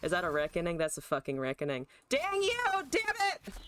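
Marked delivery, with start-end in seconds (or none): 2.1-3.5 high, squawking game rage; frustrated, high-pitched cursing; exasperated outburst. Real, not acted.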